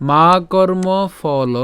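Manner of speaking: very slow মা কর্ম ফল with long vowels; careful Sanskrit pronunciation. Shown as a demonstration. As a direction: stretch out মা কর্ম ফল word by word. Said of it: "উচ্চারণটা কেমন হচ্ছে?". (খুব ধীরে টেনে মা কর্ম ফল)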